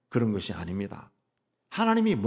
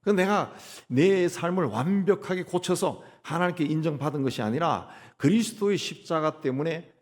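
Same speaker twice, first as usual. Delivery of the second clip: ordinary talk, nothing marked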